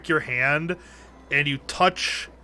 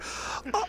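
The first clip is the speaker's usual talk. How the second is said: eerie whispered laugh; 8 dB quieter than usual. Acted. The gem's voice reacts to blood.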